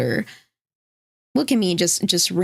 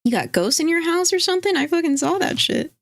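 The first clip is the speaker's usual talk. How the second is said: accusing partner; 9 semitones higher than usual. Retold or imitated. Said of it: "Grim would be the Twiggy".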